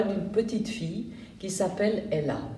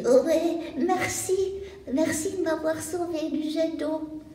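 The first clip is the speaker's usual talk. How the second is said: put-on high, small shy girl's voice; rescued girl timidly thanks Ella; 8 semitones higher than usual. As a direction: thank her shyly, barely daring.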